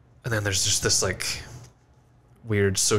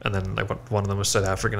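usual speaking voice

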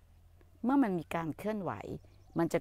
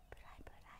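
whispered; 29 dB quieter than usual. Acted. Hushing reassurance to someone.

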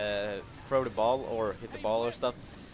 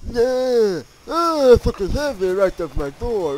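high, loud cry of frustration; loud, high-pitched gamer rage; frustrated shout; 8 semitones higher, 11 dB louder than usual. Real, not acted. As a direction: yell it out at the game. Raging at a failed play.

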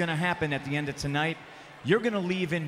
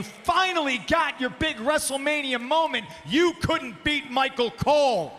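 higher, louder angry shout; raised voice; 8 semitones higher, 4 dB louder than usual. Real, not acted. Champion berating the commentator in the ring, shouting. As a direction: raise the voice and let it rip. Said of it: "I'm raising my voice right now".

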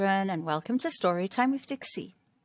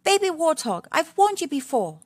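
higher, louder scolding mother warthog; mild mock scolding of a naughty child; Missus Warthog; 9 semitones higher, 5 dB louder than usual. Missus Warthog telling off Baby Warthog, higher, louder, mildly scolding.

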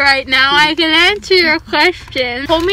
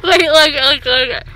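laugh-crying; 6 semitones higher, 4 dB louder than usual. Real, not acted. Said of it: "You look sad".

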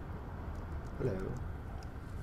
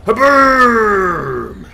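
huge, high explosive battle yell; booming shout; 8 semitones higher, 13 dB louder than usual. Pretend. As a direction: bellow it like a blast going off. Acting out an attack in play.

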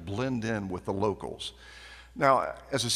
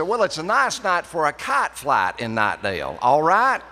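loud North Carolina country accent, softened line; country dialect demo; 7 semitones higher, 8 dB louder than usual. Shown as a demonstration. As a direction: try the local accent again with the kite line, loud and raised. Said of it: "they didn't like that".

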